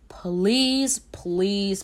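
normal talking voice

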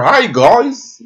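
high, failed puppet frog impression; badly faked high frog voice; Kermit the Frog. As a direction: attempt a puppet frog greeting and miss. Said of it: "Can anybody do Kermit the Frog".

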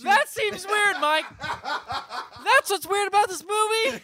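high, slow, laughing disbelief; laughing squeal. Real, not acted. Laughing at absurd movie plot.

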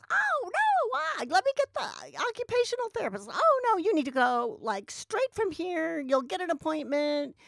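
very high, hurried alarm; mock-frantic medical worker; alarmed therapist. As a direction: play the panicking physical therapist. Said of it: "physical therapist goes".

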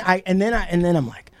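normal talking voice

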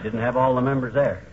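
usual speaking voice

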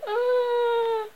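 very high long scream; acted high wail. A narrator's reading.

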